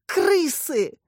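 high, panicked scream. A narrator's reading. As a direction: shriek the word in fright. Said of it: "закричала она". (панический визг)

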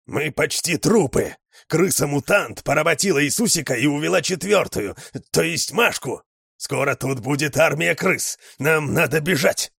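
frantic panicked shout. A narrator's reading. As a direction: yell the warning desperately. Dog yells frantic warning.

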